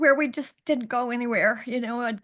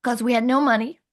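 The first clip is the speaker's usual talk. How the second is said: ordinary talk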